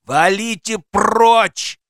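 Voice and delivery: high, loud, drawn-out yell; screeching. A narrator's reading. Man inside the flat driving them away, screeching. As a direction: screech it, stretching the last word. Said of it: "верещал голос из квартиры".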